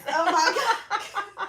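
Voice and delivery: high, loud, long-drawn laughing cry; helplessly amused outburst; laughing exclamation. Real, not acted. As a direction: crack up on a slow, high exclamation. Laughing again at the zipper mishap.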